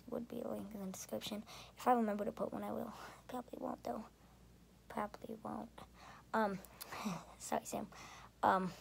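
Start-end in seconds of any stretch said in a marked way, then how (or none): 3.3-4.0 whispering, unvoiced; breathy joking whisper aside. Real, not acted. Aside admitting he won't. 4.9-5.7 whispering, unvoiced; breathy joking whisper aside. Real, not acted.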